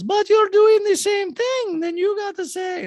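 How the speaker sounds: high, loud mocking impression of Dinah. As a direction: lampoon her objection high, loud and slow.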